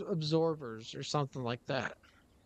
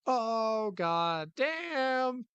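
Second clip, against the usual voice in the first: exasperated shout; 6 semitones higher, much slower than usual. Real, not acted. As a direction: yell it at the screen. Sees car's bad condition.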